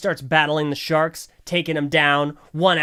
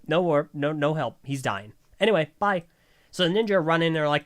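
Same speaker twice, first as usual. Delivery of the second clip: ordinary talk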